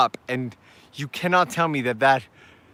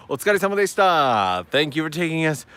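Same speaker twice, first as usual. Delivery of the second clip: ordinary delivery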